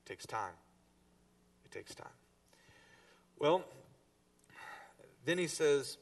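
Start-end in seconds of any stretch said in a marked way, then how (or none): 1.6-2.1 much softer, almost breathed; hushed echo of the line; hushed repeat. Shown as a demonstration. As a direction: drop it to a hush to let it sink in.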